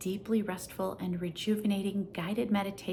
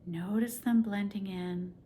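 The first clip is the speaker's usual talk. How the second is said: guided meditation; 4 dB quieter than usual. Acted. Teacher guiding a listener lying in Shavasana, soft calm meditation voice. Leads Yoga Nidra practice.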